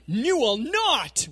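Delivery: high, slow, loud mock refusal; preacher replaying Peter's refusal, hammed-up; mock stern refusal. Peter's refusal replayed, high slow and loud. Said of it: "complete one eighty".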